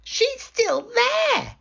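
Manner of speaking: very high, drawn-out cub wail; tiny bear voice, upset; squeaky Baby Bear. Acted. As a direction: squeak it slowly in alarm. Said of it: "squeaked Baby Bear in his tiny voice".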